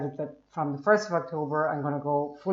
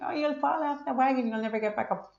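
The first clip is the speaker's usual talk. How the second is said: mocking keto people; 9 semitones higher than usual. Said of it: "some of the keto people are like".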